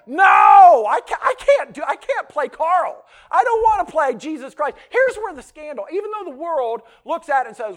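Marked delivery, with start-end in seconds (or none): 0.1-4.8 high, shouted protest; panicked refusal. Acted. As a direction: stammer and cry out against the role. Husband protests the impossible role.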